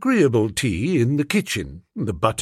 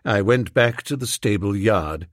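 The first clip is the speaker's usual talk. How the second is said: ordinary delivery, nothing marked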